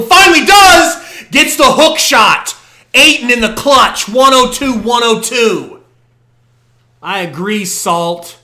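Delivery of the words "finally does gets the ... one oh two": yelling the play, high and loud; excited shout. Real, not acted. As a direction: erupt as the hook shot drops. Excited call of clutch basket.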